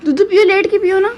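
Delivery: sing-song mummy voice. Real, not acted. Urging baby to drink milk.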